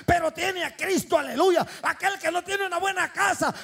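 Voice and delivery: loud, high fervent preaching; full-force preaching. Acted.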